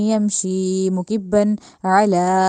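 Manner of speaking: मुकिब्बन अला chanted with ain from the throat; slow recitation. Shown as a demonstration. Teacher demonstrates the verse to students, slow chant. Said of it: "उसको हलक से निकालना है".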